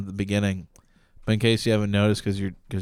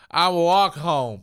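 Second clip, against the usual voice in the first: put-on drunk guy voice; 6 semitones higher, much slower than usual. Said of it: "He's like".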